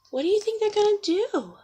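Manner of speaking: ordinary delivery, nothing marked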